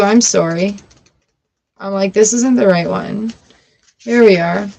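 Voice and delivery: ordinary delivery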